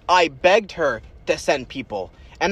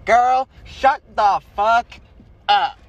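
mock snap, high and drawn out; loud, slow, each word stretched out; Abby; 7 semitones higher, 5 dB louder, much slower than usual. Retelling Abby's message to the friends, slow loud mocking. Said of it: "Abby slides up and goes".

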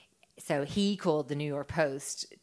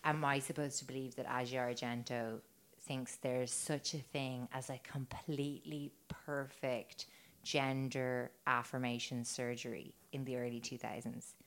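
hushed quote; 4 semitones lower, 8 dB quieter than usual. Retold or imitated. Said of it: "I read one commenter say".

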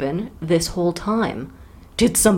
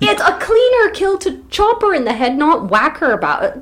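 insistent; 7 semitones higher, 3 dB louder than usual. Real, not acted. Arguing playfully.

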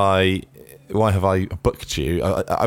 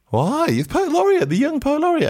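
excited. Real, not acted. Teasing disbelief at friend's secret.

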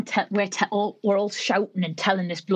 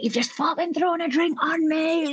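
high-pitched mock outrage; retold furious accusation, pitched right up; seething; 10 semitones higher than usual. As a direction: imitate a fuming woman in a high voice. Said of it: "absolutely seething, like, smoke coming out".

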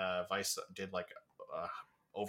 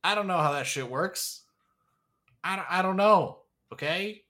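exasperated; 9 semitones higher, 7 dB louder than usual. Real, not acted. Exasperated about social media.

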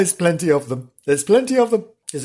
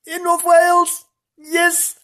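sing-song; slower than usual. Acted. Sing-song high announcing.

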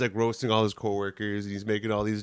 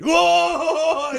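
laughing whoa; 7 dB louder than usual. Real, not acted. Reacting to the line.

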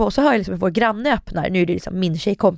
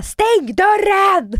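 high, drawn-out re-enacted shout; exasperated yell; 11 semitones higher, 3 dB louder, much slower than usual. Woman yelling at her partner to close up, exasperated. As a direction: yell the order, stretching it out.

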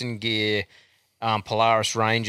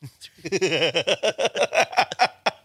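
high cackling laugh; laughter; 7 semitones higher than usual. Real, not acted. Host relishes the repeated punchline, laughing hard. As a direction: crack up in a long run. Cracks up at the nickname.

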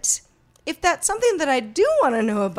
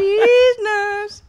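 cracking up, high and loud; laughing; 12 semitones higher, 7 dB louder than usual. Real, not acted. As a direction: say it through laughter. Repeats joke name laughing.

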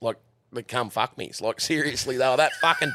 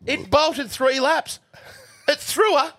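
excited retelling; 13 semitones higher than usual. Real, not acted. Telling his mates about the bolting horse, excited. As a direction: build up the wild bolt, cracking up.

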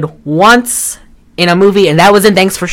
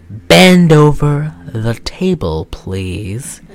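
drawn-out order; much slower than usual. Retold or imitated. Myra telling Rusty to bend over, slow controlled order. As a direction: stretch each word out with control.

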